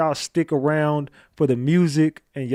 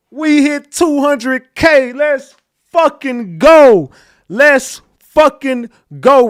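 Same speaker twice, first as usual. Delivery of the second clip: excited shouting; 10 semitones higher, 10 dB louder, slower than usual. Real, not acted. Celebrating hitting 200K subscribers.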